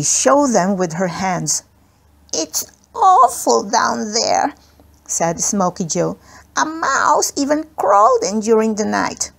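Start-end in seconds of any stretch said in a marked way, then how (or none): 2.3-4.5 put-on high, slow, timid child's voice; a frightened little boy, drawn out; small boy voice. A narrator's reading. Smokey Joe, a small scared boy. 6.6-9.3 put-on small boy voice. A narrator's reading. Smokey Joe, a small scared boy.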